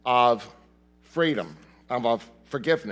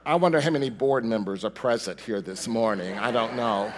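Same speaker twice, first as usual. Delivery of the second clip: usual speaking voice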